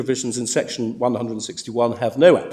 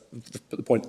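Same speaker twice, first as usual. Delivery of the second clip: ordinary talk, nothing marked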